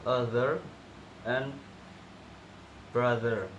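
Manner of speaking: "Other and brother" with a clear voiced th; tongue between teeth on "brother"; correct th. Shown as a demonstration. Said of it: "slightly bitten to produce the sound".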